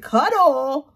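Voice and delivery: high, loud disbelieving cuddle; incredulous echo. Retold or imitated. Repeating the date's cuddle request in shocked disbelief. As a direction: fire it back in disbelief.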